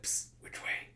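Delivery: whispered hiss for attention; hushed whisper. Acted. Henchman asking his party the way, hushed whisper. Goblins sneaking, whispered question.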